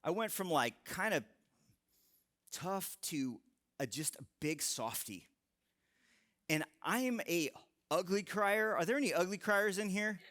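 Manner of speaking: ordinary delivery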